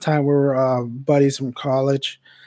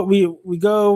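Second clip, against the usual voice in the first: normal talking voice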